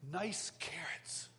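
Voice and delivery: slow whispered joke. Retold or imitated. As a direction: breathe it out slowly as a secret aside. Whispering joke to his dad.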